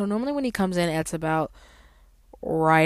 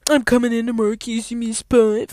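mock entitled customer; 6 semitones higher, 5 dB louder than usual. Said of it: "be like".

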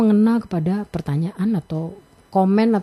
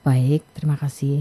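lower, steady, assertive demo; low calm tone; 5 semitones lower than usual. Shown as a demonstration. Expert showing the right low intonation to the host. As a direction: set the pitch low and steady for authority.

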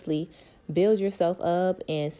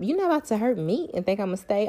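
usual speaking voice

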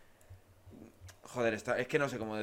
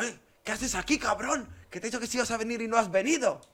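imitating the friend scolding, higher and quick; mock angry confrontation; 8 semitones higher, 4 dB louder than usual. The friend scolding him at the station, mock angry. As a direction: retell his reproach, higher and fast.

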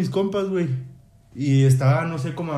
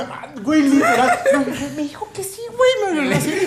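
high, laughing excitement; excited; 9 semitones higher than usual. Retold or imitated. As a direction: burst with giddy joy. Recuerda la emoción del sí. (emoción aguda entre risas)

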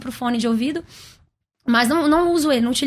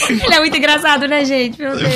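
louder words broken by real laughter; laughing; 3 semitones higher, 6 dB louder than usual. Real, not acted. Singer laughing at the host's hearing prank. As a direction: laugh it out after being pranked.

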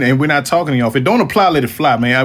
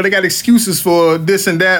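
ordinary talk

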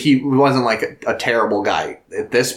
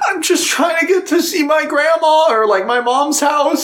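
very high, laughing, crying mock plea; mock pleading student. Student begging to cross the tracks, high whiny laughing plea. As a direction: whine it like a desperate girl.

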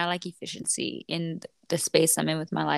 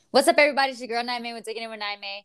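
podcast intro; 4 semitones higher, much faster than usual. Acted. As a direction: welcome listeners like a radio host.

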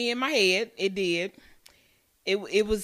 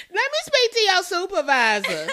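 mock demanding customer; 9 semitones higher, 4 dB louder than usual. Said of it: "cue the tone, baby".

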